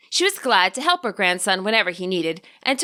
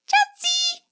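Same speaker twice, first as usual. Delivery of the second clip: much higher, squeaky creature call; delighted Pokemon cry. A narrator's reading. Chansey greets Ingo and Silene, squeaky delighted cry. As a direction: chirp its own name with joy. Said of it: "cried with delight".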